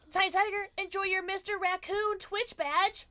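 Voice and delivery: a bit of a cartoon voice; high silly voice. Thanking sub in high voice.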